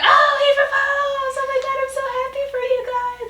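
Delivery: high, fast, squealing shout of joy; thrilled squeal. Retold or imitated. Best friend hears the proposal news, squealing with joy. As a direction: shriek with delight for them. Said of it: "she's like".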